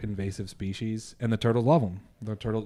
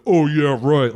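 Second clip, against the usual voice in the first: sarcastic mom; 4 semitones higher, 8 dB louder, slower than usual. Retold or imitated. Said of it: "the mom's gonna be like".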